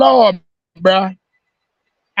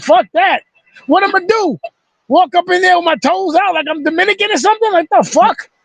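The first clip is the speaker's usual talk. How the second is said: mock outrage; 8 semitones higher than usual. Retold outburst at his mom.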